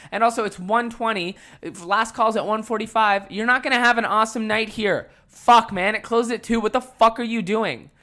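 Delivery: loud, high, exasperated ranting; heated rant. Retold or imitated. To the drunk customer who ignored the rules, a loud exasperated rant. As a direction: vent at her like she's right there.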